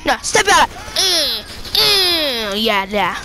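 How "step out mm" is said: ordinary talk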